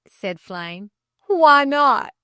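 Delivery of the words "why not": loud, insistent question; demanding. Acted. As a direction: demand an answer through the door. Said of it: "demanded the lay reader".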